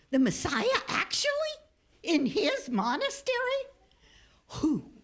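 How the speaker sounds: mildly dramatized shock and puzzlement; shocked abbot. A narrator's reading. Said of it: "the abbot was shocked".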